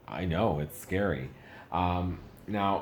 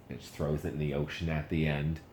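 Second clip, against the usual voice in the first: ordinary talk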